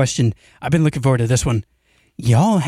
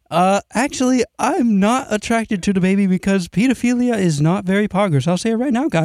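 lightly voiced, higher nerdy know-it-all; a hint of a smug nerd correcting everyone; Jacob impression; 6 semitones higher than usual. Friend mocks Jacob in a podcast bit, a light higher smug nerd voice. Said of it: "Here's my impersonation of Jacob".

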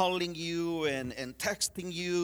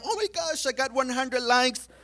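high squeal of delight, teasing; parodying a social media brag; gushing mock glee; mock excitement; 7 semitones higher than usual. Mocking someone proud of likes.

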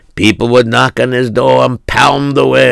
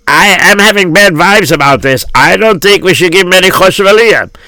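put-on higher-pitched old-world character voice; gossiping gabbai; 5 semitones higher than usual. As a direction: play a suspicious synagogue official conferring. Gabbai gossips about the miser.

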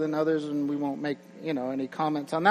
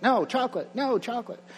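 put-on toddler refusing lunch; 7 semitones higher than usual. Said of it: "it's like".

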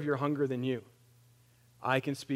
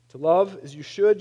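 usual speaking voice

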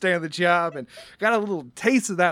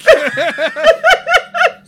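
big laugh; 13 dB louder than usual. Real, not acted. GM laughing at joke.

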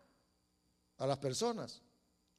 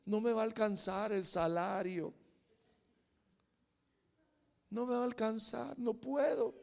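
mock defeated talk; 9 dB quieter than usual. Voicing fearful, defeated churchgoers.

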